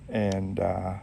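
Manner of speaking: ordinary delivery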